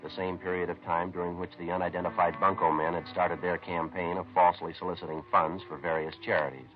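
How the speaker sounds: measured, matter-of-fact detective narration over music; steady procedural voice-over telling the investigation; crime story narration. A narrator's reading. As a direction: report the case like a tired police sergeant. Police narrator recounts the case.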